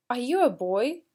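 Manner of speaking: put-on "boy?" rises clearly at the end; teacher modelling rising intonation for students; rising question tune. Shown as a demonstration. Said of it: "we need to raise our intonation".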